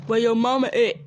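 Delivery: loud mock yell; retold scream. Man at the window to child, mock screaming. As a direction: yell it out as an imitated shouting man. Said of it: "and he screamed".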